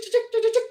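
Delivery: very high imitated chirping; bird chirp. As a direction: chirp it like a small bird at night. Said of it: "the other ones that chick".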